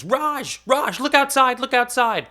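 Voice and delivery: very high, very loud shriek of a name; grandma shrieking at the snow; excited scream. Retold or imitated. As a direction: yell it from across the house, thrilled. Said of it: "screaming at the top of her lungs".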